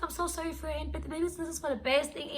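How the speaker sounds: high-pitched sympathetic friend voice; consoling friend. Retold or imitated. Friend on the phone consoling her about the breakup, high sympathetic voice. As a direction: imitate a friend offering gentle comfort, pitched high.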